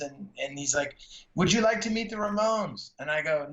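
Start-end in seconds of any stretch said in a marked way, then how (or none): 1.3-2.9 high-pitched imitated Mexican accent. Lighting man offers to introduce a fan, Mexican accent. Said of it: "had this really thick Mexican accent".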